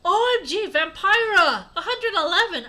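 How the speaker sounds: high, loud excited shriek; yelled. Acted. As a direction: squeal the greeting at full volume. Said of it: "I yielded".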